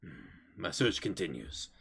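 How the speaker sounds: low, quiet menacing figure; hushed, low voice of a searcher; suspicious hulking stranger. Acted. Bolt speaking in the game.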